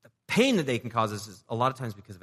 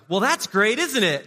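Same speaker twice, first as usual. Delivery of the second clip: high, loud sarcastic whine; mock the grumbler with fake cheer; grumbling at something with sarcastic cheer; sarcastic grumble; 10 semitones higher, 8 dB louder, slower than usual. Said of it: "A little bit more sarcastic".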